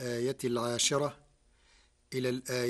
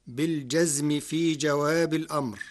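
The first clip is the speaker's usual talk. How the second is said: normal talking voice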